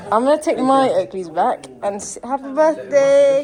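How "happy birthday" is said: happy birthday stretched out, louder and higher; slow, loud, drawn-out celebration; excited greeting. Real, not acted. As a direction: sing it out like a big reveal. Greeting brother with cake.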